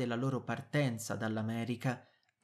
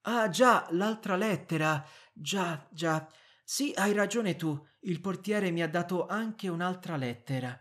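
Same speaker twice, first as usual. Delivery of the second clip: feigned stalling; 5 semitones higher, 5 dB louder than usual. A narrator's reading. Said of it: "fingendo di non poter cambiare argomento".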